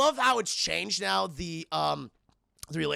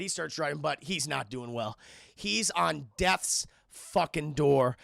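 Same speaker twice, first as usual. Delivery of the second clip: ordinary talk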